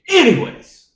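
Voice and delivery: high, loud, emphatic segue; loud topic change. Real, not acted. Host dragging the show back on topic, loud interruption. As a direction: burst out to cut off the tangent.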